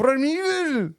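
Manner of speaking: high British voice; British accent. Shown as a demonstration. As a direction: mimic a British pronunciation. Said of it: "we can do a British pronunciation".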